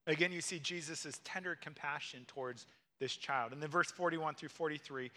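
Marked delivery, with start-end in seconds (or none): none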